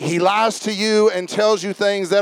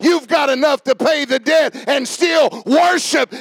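building sermon climax; 5 semitones higher than usual. Real, not acted. Preacher tells congregation they got joy unspeakable, building to full-force preaching. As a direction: drive it up to a shouted, high-pitched peak.

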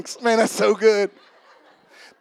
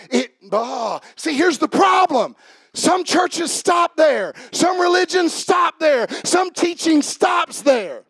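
full-force preaching; 9 semitones higher, slower than usual. Acted. Pastor preaches grace to the congregation, booming. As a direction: drive it home to the congregation.

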